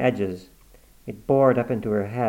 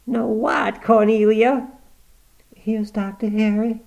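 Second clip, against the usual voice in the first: put-on higher, louder peevish old mother; cranky old woman; 11 semitones higher, 5 dB louder than usual. Granny complaining about her face being washed, peevish. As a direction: grumble like a stubborn invalid.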